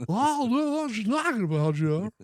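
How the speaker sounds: put-on high, loud, fast old broadcaster; Harry Caray impression. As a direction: bluster like a boozy announcer. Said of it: "like Harry Caray".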